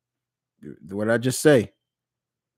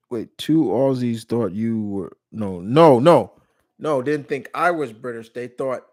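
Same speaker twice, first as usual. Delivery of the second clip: normal talking voice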